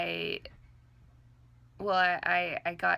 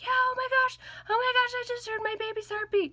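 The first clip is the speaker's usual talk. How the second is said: much higher, louder, giddy squeal of joy; excited boyfriend; 6 dB louder than usual. Retold or imitated. Boyfriend calling after hearing baby's heartbeat, overjoyed high squeal. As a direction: gush like a new dad who just heard the heartbeat. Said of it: "He was freaking out about it".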